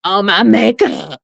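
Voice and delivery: slow, stuffy mock echo of the clip; a hint of a congested nose, slowed down; mimicking congested voice. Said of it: "sounded like Shamar".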